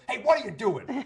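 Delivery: loud, high challenge hollered across the street; yelling cop. Retold or imitated. Cop hollering at the man stopping traffic, yelling. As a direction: re-enact a cop yelling from afar. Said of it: "a cop across the street yelling at me".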